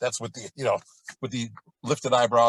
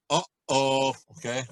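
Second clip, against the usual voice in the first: ordinary delivery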